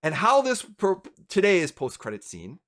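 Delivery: ordinary delivery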